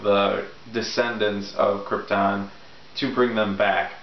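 ordinary talk

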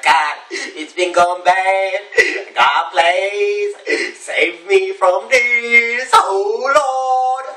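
high, drawn-out, hammed-up wail; mock wailing plea. Backsliders begging God to save them, mock wailing.

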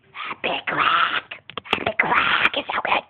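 soft, slow whisper. Real, not acted. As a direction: whisper it like a secret. Whispering to camera.

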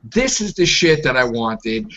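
ordinary talk, nothing marked

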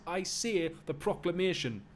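insistent, high-pitched Geordie; impatient Geordie strongman; Geordie accent. Acted. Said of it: "give him a Geordie accent".

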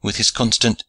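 plain reading voice

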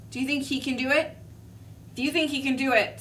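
ordinary delivery

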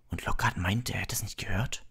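hushed, breathy whisper; don't wake the sleepers. A narrator's reading. Harry and Ron whisper so the dorm won't wake. Telling quietly, friends sleeping.

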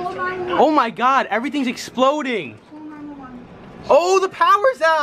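startled cry, pitched well up; real shocked excitement. Real, not acted. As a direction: exclaim in alarm as it happens. Power lines explode in recorded storm.

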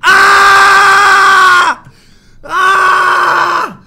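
long loud agonized scream; tortured scream; scream of pain. Acted. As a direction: howl as the nail comes out. Fingernail being pulled out.

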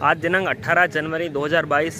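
usual speaking voice

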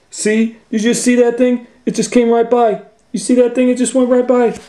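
high, loud, fast panic; high frantic rush; panicky Shaggy. Acted. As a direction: rattle it off terrified. Shaggy scared of shape.

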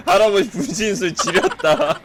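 high-pitched words breaking into laughter; laughing hard. Real, not acted. Bursts out laughing at game.

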